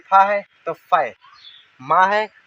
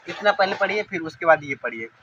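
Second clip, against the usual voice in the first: ordinary delivery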